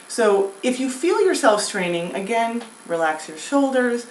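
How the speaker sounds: ordinary talk, nothing marked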